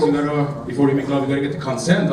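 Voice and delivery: normal talking voice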